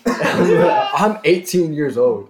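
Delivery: loud, high and laughing. Real, not acted. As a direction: laugh it out slowly. Laughing at being older.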